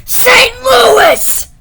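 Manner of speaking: very high, loud yell; excited shout. Real, not acted. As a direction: shout the big reveal. Revealing where they live.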